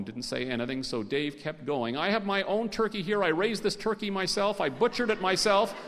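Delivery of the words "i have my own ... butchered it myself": high, loud, insistent claims; worked-up bluffing, pitched high; desperate improvising. A narrator's reading. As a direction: pile up claims, each more emphatic. Dave keeps going, improvising desperately.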